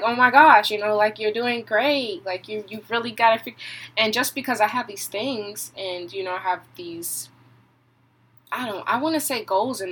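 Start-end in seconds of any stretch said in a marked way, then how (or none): 0.0-3.6 gushing praise. Retold or imitated. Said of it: "people are just like".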